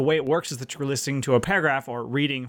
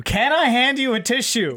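much higher, louder incredulous repeat; exasperated; 10 semitones higher, 4 dB louder than usual. Real, not acted. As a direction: throw the question back in disbelief.

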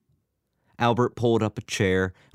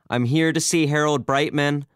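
louder, much higher, insistent; raised voice, pressing to be heard; more forceful; 9 semitones higher, 5 dB louder than usual. A narrator's reading. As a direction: repeat it forcefully to someone ignoring you. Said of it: "Albert said with more force".

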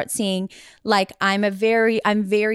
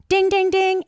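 imitated chime; 10 semitones higher, 3 dB louder than usual.

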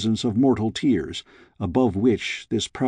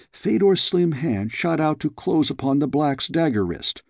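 plain reading voice

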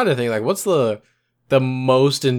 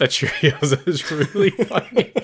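laughing; 4 semitones higher, slower than usual. Real, not acted.

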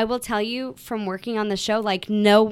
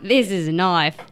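hammed-up Aussie movie line, laughter bubbling under; Australian accent; 3 semitones lower, 3 dB louder than usual. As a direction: do a Crocodile Dundee Australian. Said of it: "I could do an Aussie".

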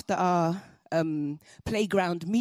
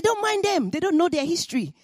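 much higher, louder brush-off; imitating mum; 9 semitones higher, 3 dB louder than usual. Mum brushing off Ayodele's worries, higher and louder dismissal. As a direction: wave the worry away like a mother.

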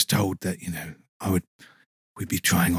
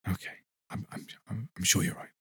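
much softer meek reply; very quiet; 8 dB quieter than usual. Acted. Guest meekly concedes in a near whisper. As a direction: barely breathe it out.

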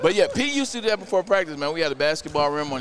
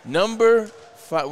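top ten countdown; 4 semitones higher, 4 dB louder, much slower than usual. Acted. Countdown announcement.

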